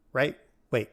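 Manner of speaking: usual speaking voice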